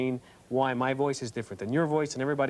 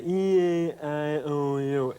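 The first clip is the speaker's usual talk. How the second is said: nasal vowels; much slower than usual. Shown as a demonstration. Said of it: "very tough to talk like that".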